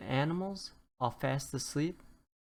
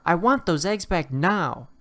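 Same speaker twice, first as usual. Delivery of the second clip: stern demand; 4 semitones higher, 7 dB louder than usual. A narrator's reading. Rooster bangs on the door.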